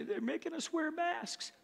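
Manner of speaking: high, very soft put-on grumbler; mock complaint. Preacher imitating people upset over masks, mock complaint.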